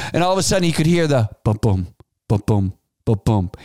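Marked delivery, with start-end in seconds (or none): 1.6-3.5 low, slow imitated heartbeat thump; heartbeat sound. Doctor's checkup on the mother's belly, deep heartbeat imitation. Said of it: "He could hear the heartbeat".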